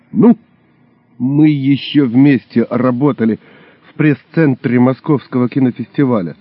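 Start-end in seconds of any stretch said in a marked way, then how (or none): none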